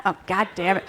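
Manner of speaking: usual speaking voice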